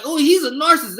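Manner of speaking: high, loud sneering mimic of her; mocking quote. Mimicking the ex's accusation in a loud, high sneer. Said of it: "she's like".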